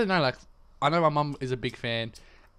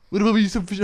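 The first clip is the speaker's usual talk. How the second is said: higher, louder mock Kiwi voice; mock New Zealand accent; 7 semitones higher, 7 dB louder, faster than usual. Shown as a demonstration. Attempting a New Zealand accent for his friend, clumsy. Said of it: "I'm gonna have my New Zealand accent".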